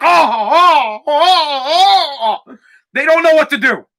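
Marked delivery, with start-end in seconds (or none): 0.0-2.6 scandalized, higher drawn-out gasping ohs; mock horror. Mocking a community appalled by a fart, mock horror. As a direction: overplay the shock in rising waves. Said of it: "it's a mortal sin. Like".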